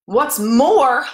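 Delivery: loud, high angry snap; the Lorax snaps at the Onceler, loud and angry; snapped. A narrator's reading. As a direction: bark it out with his dander up. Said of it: "snapped the Lorax, his dander was up".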